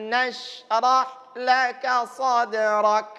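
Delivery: slow recitation, lips moving only on the m; careful lip movement. Shown as a demonstration. Teacher shows lip movement, slow. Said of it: "Pergerakan bibirnya perhatikan".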